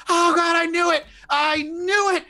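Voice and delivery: high, frantic panicked wail; freaking out. Acted. NPC Janice sees the changed hallway, panicking. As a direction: spiral into hysterical panic. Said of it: "she actually starts freaking out".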